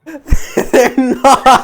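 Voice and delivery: high, loud cry through laughter; loud laughing denial; incredulous laughing. Real, not acted. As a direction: laugh it out in disbelief. Incredulous at game's romance.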